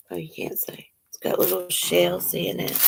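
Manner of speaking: soft whispered aside. Real, not acted. Showing the necklace to viewers, a soft whispered aside. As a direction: whisper it to yourself.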